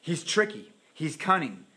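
ordinary talk, nothing marked

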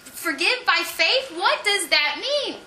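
high, disbelieving outrage; can't believe what she's hearing; incredulous. Retold or imitated. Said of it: "I'm like".

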